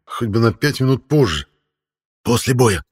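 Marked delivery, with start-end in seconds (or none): none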